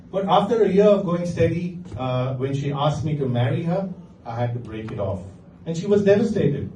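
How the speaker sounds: ordinary talk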